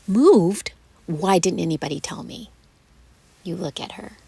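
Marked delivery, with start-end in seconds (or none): 0.1-2.5 much louder startled gasp into the question; shocked gasp. Acted. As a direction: caught off guard, outraged. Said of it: "She gasped".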